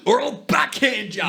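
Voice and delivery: high, hammed-up yell; imitated parent yelling a threat; mock parent threat. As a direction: threaten the kid like an exasperated parent, playfully. Acted parent threatening the child.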